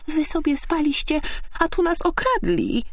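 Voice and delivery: very high, urgent squeal; agitated squirrel. A narrator's reading. Squirrel telling sleepers of a theft, agitated high voice. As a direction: break the news in high-pitched alarm. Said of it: "powiedziała z przejęciem".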